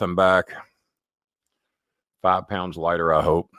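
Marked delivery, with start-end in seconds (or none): none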